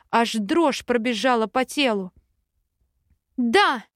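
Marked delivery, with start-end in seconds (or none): 3.4-3.8 a bright shouted yes. Acted. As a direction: call it out with resolve. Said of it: "в третий раз выкрикнула я".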